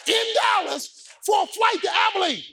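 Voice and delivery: high, shouted sermon climax; full-force preaching. Real, not acted.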